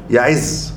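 يعز said ya-IZZ, with an i after the ayn; kasra on the ayn. Shown as a demonstration. Teacher showing the verb meaning inaccessibility, يعز with kasra on the ayn. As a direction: put a short i on the ayn in يعز. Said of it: "بكسر العين".

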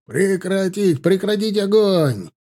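high-pitched cease-fire command; calling an order. Acted. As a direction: call it out to the whole squad.

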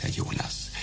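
name breathed in a whisper; whispered address. Acted. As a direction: whisper it intimately.

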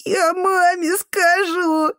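put-on very high, slow child's wail; sobbing wail. A narrator's reading. Boy just slapped by the janitor, sobbing. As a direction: wail the threat through sobs. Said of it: "зарыдал мальчишка".

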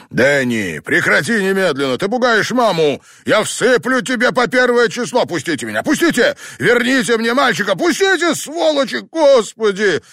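fast, very high, shrieking fury; hysterical screaming. A narrator's reading. Father dragged from the grave, screaming hysterically at the men. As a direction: scream and fight against the hands holding you.

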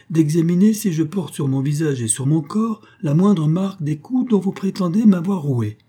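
de plus en plus haut et fort; femme indignée qui monte. Acted. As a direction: protester avec hauteur. Simone se défend, montant. (Simone, offensée, prend tous à témoin)

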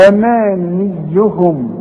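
أمانيهم with the mim stretched to a full alif, slow; أمانيهم with the long vowel held. Shown as a demonstration. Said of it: "مد الميم دي ألف كاملة".

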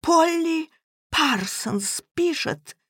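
acted groaning lament. A narrator's reading. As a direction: moan the dreadful news out. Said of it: "простонал Эдвард".

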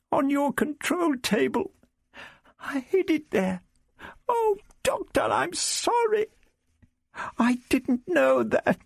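put-on very high, tearful girl's apology; near sobbing. A narrator's reading. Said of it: "Vicky began to sob".

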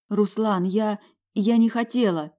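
high, loud frightened plea; panicked. Acted. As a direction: blurt it out in panic. Panicked apology to Ruslan.

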